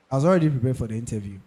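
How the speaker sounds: "already" said fast and light in a brisk repeat; "already" run through fast, not stressed; quick restatement. Shown as a demonstration. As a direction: rattle it off quickly.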